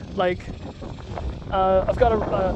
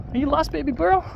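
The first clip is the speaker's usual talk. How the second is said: much higher, sing-song pet voice; 8 semitones higher, 4 dB quieter than usual. Real, not acted. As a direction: coo gently at a lost puppy. Talking to a lost dog.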